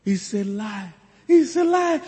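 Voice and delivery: high squealing delighted lady; gushing lady greeting. Retold or imitated. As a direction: squeal it like an excited woman. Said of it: "the way ladies greet, they greet with compassion and emotion".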